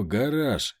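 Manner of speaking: usual speaking voice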